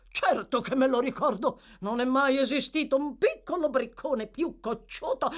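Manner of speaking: messo parrocchiale pomposo, voce più alta e forte; voce di Bumble. Acted. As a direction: Bumble sbraita contro il ragazzo. Bumble's character voice. (Bumble ricorda Oliver con disprezzo, voce alta)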